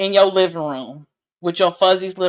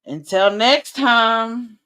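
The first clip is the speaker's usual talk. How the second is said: slow, much higher, drawn-out holler; signature sign-off; 5 semitones higher, 4 dB louder, much slower than usual. Acted. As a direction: sing out the catchphrase slowly. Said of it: "what I always say".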